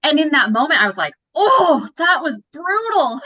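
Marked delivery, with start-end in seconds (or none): 1.3-3.2 stung surprise. Retold or imitated. Reacting to friend's harsh words.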